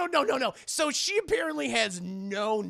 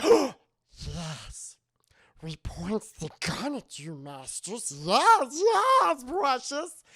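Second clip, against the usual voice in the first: Gollum voice; 4 semitones higher, slower than usual. Retold or imitated. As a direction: slip in and out like Gollum. Gollum Girl groveling.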